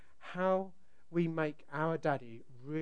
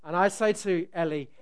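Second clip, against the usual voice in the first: ordinary talk, nothing marked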